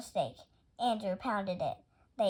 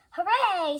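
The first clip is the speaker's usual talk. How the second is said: cheering; 9 semitones higher, 7 dB louder than usual. Acted. Andrew cheers about building.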